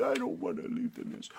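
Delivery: high, teary and breathless; crying little bird. A narrator's reading. Little bird who bumped her head, crying. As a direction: sob it out like a hurt chick. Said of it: "she started crying".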